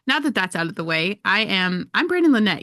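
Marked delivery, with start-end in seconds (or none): 1.9-2.6 bright, higher, louder intro; excited self-introduction. Real, not acted. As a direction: announce your name with a big lift. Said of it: "I've never introduced myself on the show".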